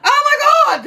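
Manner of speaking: high, loud shriek of shock; shocked gasp. Retold or imitated. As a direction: recoil in horror at the sight. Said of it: "And she went".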